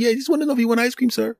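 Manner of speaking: very high, rapid put-on voice; impatient high-voiced takeout worker; food counter worker. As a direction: chirp it fast, way up in pitch. Counter worker answers customer in story.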